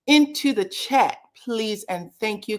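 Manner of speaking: ordinary delivery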